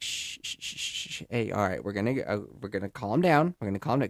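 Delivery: soft shushing, then hushed calming words; soothing shush. Retold or imitated. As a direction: hush a fussy toddler down gently. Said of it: "just kinda like".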